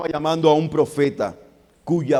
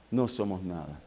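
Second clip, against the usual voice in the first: quiet; 8 semitones lower, 8 dB quieter than usual. Acted. Repite en voz baja.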